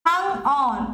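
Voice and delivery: "hung" unstressed, "on" held longer; stress on ON. Shown as a demonstration. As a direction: scan it as an iamb, weight on "on". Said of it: "একটা unstress পাচ্ছি, একটা stress পাচ্ছি". (on-এ টেনে জোর)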